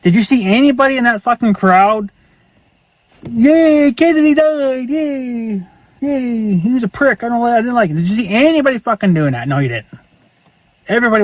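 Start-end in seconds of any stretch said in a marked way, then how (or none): 3.3-7.9 mock cheering. Mocking a crowd that cheers a president's death, high gloating cheer. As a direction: sneer out a gleeful crowd's yay.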